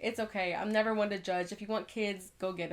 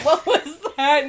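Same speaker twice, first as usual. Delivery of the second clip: slow, louder, laughing what was that; amused disbelief, laughing; 5 semitones higher, 11 dB louder, slower than usual. Real, not acted. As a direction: laugh through the words. Laughing echo.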